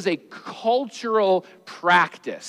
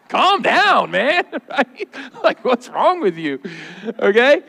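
mock exasperation; 4 semitones higher, slower than usual. Comic retelling, casual brush-off.

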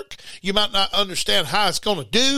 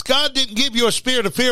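ordinary talk

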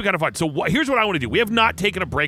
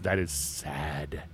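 mock gloom; 12 semitones lower, 10 dB quieter, much slower than usual. Mock gloom about drinking.

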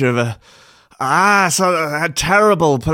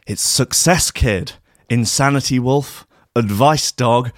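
normal talking voice

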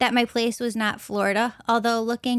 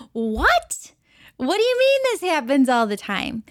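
higher, louder retold disbelief; shocked disbelief; 4 semitones higher, 3 dB louder than usual. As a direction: replay your stunned reaction, voice lifted. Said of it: "I'm just shocked and stunned".

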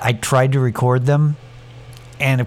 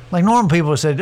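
usual speaking voice

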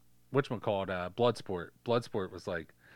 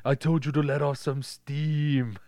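Arnold impression; 5 semitones higher, slower than usual. Quoting Commando as Arnold.

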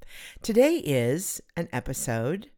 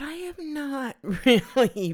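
giggling; 12 semitones higher, 5 dB louder, slower than usual. Real, not acted. Giggling mid-sentence.

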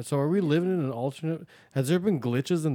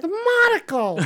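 excited shout; 6 dB louder, slower than usual. Real, not acted. Excited blurt about the monocle.

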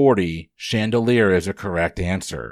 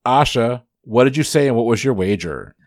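ordinary talk, nothing marked

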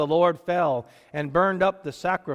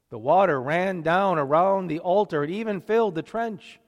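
ordinary delivery

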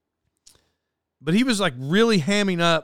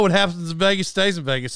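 usual speaking voice

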